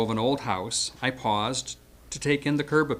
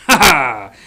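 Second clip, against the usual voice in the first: triumphant shout; 14 dB louder than usual. Retold or imitated. Fencers taunting on the roof.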